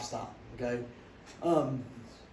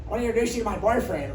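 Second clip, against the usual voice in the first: higher, quick, bright announcement; lightly voiced chirpy teammate; 6 semitones higher, 6 dB louder, faster than usual. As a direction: rush out the news eagerly.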